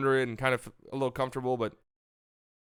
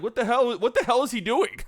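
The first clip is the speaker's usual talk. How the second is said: mock coach outrage; 9 semitones higher, 4 dB louder than usual. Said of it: "that coach is like".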